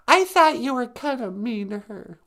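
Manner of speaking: high-pitched little character voice; mildly reproachful sidekick. Acted. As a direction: gently chide him in a puppet squeak.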